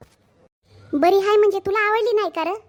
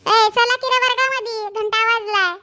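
calling out; 5 semitones higher than usual. Acted. Teacher-like call to class.

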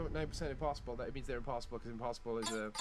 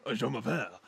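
lower, hammed-up French; funny French accent; 4 semitones lower, 3 dB louder than usual. Shown as a demonstration. Friend showing how obviously you go, comic French accent. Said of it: "couldn't do the funny voice".

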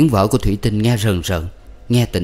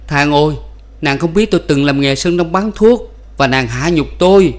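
raised, complaining cry to heaven; husband protesting to God, lightly raised lament; wounded lament; 6 semitones higher than usual. A narrator's reading.

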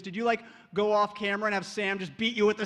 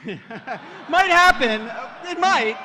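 laughing tease; 4 semitones higher, 8 dB louder, much slower than usual. Real, not acted. Teasing joke about tree scene.

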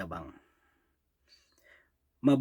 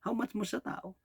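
"How much more sa tao?" asked again higher and softer, almost to himself; softer higher repeat; 6 semitones higher, 8 dB quieter than usual. Acted. Preacher repeats his question to the congregation, higher and softer. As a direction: lift it up and pull back the volume.

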